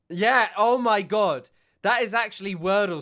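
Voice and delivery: excited. Real, not acted. Reacts to the Wordle answer.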